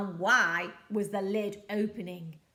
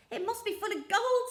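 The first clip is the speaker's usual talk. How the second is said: eager pirate; 11 semitones higher, 4 dB louder, faster than usual. Acted. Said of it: "said Handkerchief".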